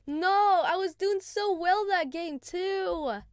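loud drawn-out lament; dismayed groan. Real, not acted. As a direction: stretch it out in frustrated dismay. Dies after playing well.

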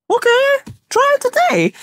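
squeaky falsetto mimicry; high mock voice. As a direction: pitch it way up in a mocking squeak. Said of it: "I was like".

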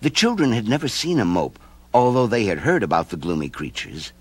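ordinary delivery, nothing marked

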